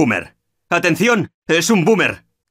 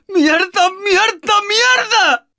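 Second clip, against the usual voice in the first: high rising panicked yells; desperate cursing; much slower than usual. Acted. Teammate dying.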